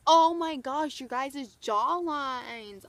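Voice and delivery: loud, drawn-out gush with a slow ending; gushing compliment. Retold or imitated. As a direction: marvel out loud, stretching the last word. Taylor gushing over jawlines.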